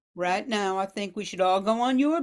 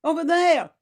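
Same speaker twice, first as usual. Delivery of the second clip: urgent pointing call; 7 semitones higher, 6 dB louder than usual. Acted. Spotting survivors.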